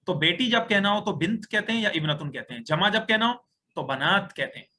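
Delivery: ordinary delivery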